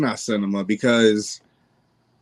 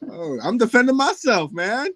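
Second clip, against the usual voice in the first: higher mock-defensive voice; mocking imitation; 10 semitones higher than usual. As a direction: parrot him teasingly. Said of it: "I gotta defend myself here".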